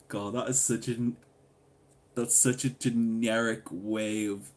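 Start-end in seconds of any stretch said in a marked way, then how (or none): none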